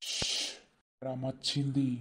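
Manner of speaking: quiet, hushed tip-off; hushed warning. Acted. Husband hushing his wife as Rama arrives.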